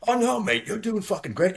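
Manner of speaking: high-pitched imitation of an English mate; reassuring English kid. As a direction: buck up a nervous pilot in an imitated English voice. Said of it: "English kid who was a naturalized American".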